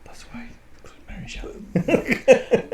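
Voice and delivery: a high, breathy stage whisper as a joke; mock whisper. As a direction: whisper it like a silly secret. Joking aside about the author.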